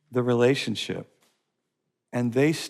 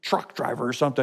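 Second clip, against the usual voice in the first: ordinary talk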